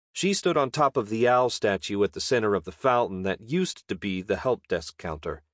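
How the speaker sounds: ordinary delivery, nothing marked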